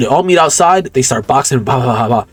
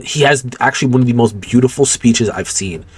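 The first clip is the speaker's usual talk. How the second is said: usual speaking voice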